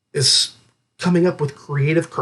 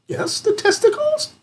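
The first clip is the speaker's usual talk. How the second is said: pitched-up incredulous aside. Real, not acted. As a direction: voice leaps way up on testicles. Mock disgusted emphasis.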